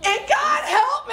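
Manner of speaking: high, loud crying plea; high, loud, half-laughing mock wail; desperate shout. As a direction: break into a wailing cry for help. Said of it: "your prayer changes to".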